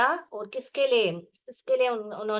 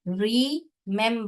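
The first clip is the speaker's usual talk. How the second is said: ordinary delivery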